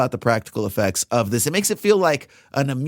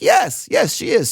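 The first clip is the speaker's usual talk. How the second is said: mock eager yes; 9 semitones higher than usual. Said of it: "it's like".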